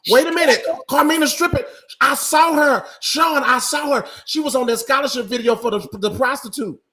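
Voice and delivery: high-pitched, loud exclaiming; excited outburst. Real, not acted. Co-host telling Sean she saw Carmina on a video, high excited outburst. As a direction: blurt it out like scandalous news, voice way up. Mock outrage at a spotted acquaintance.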